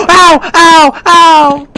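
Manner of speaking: high, sharp cries of pain; pained yelps. Real, not acted. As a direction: yelp loudly at each hit. Being hit in the game.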